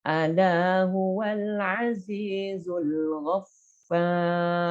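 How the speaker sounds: slow chanted recitation; Quran recitation. Shown as a demonstration. Teacher recites an example verse, slow chanted recitation. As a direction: draw the verse out slowly in tajwid style. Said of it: "Contoh misalnya".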